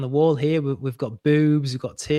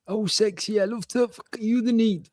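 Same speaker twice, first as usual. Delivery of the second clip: put-on Geordie accent; 8 semitones higher than usual. Reading comic speech bubble.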